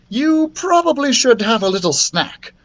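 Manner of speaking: high-pitched princess voice; scheming princess. A narrator's reading. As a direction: suggest it coaxingly.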